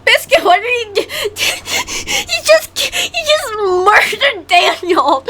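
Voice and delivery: high-pitched, breathless real laughter through the words; laughing hard. Real, not acted. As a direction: crack up while exclaiming in disbelief. Cracking up at animation.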